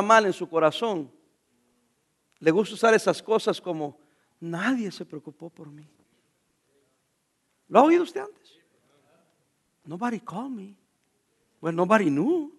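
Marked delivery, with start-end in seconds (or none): 4.4-5.9 self-pitying whine. Retold or imitated. Voicing a self-pitying, depressed believer. 9.8-10.8 self-pitying mutter. Retold or imitated. Voicing a self-pitying believer in English.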